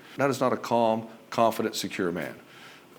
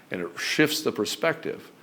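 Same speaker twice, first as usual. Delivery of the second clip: ordinary delivery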